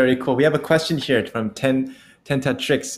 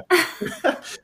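high squeal of a laugh. Real, not acted.

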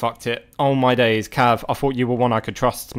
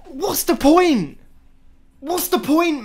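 exasperated cry; 13 semitones higher, 3 dB louder, slower than usual. Real, not acted. Despair after conceding.

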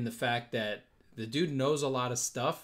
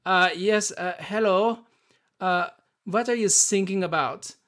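German guy; 7 semitones higher, 9 dB louder than usual. Retold or imitated. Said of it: "the German guy is like".

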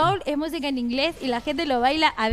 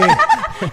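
high, loud burst of laughter; 11 dB louder than usual. Real, not acted. Joking about costs.

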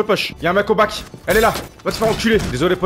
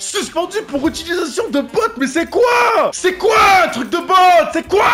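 angry shout; 12 semitones higher, 5 dB louder than usual. Real, not acted. Outraged at ban message.

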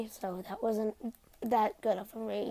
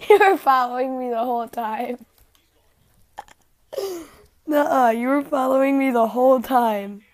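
loud laughing accusation; laughing loudly; 2 semitones higher, 10 dB louder than usual. Real, not acted. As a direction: shout it through laughter.

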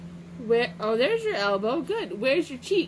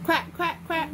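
higher, short repeated quacks; duck quack; 4 semitones higher than usual. Pretend. Parent demonstrating for a toddler, playful duck quack. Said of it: "quack like a duck".

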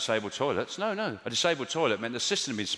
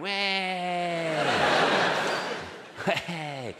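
put-on drunken cheer; 5 semitones higher than usual. Drunk girl on tabloid photo.

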